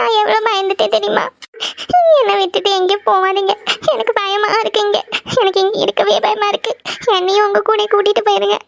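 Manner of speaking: high, tearful pleading with sobbing gasps; sobbing in fear. Acted. Frightened wife begging her returned husband to stay, sobbing. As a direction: plead through frightened sobs. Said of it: "எதுக்கு இப்ப இப்படி தேம்பி தேம்பி அழுதுட்டு இருக்க".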